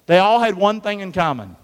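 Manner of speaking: loud, high call to the room. Shown as a demonstration. Preacher calling the line loudly to the congregation. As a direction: push it out to the back row. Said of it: "Scream it out".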